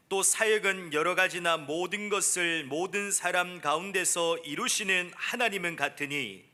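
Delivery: usual speaking voice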